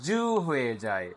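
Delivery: ordinary delivery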